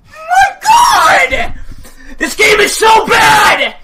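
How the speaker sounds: furious screaming rant; raging gamer. Real, not acted. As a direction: scream in raw frustration.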